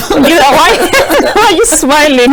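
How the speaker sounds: high, laughing teasing question; laughing tease. Real, not acted. Host teasing her guest about the north, laughing. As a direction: laugh while you tease him.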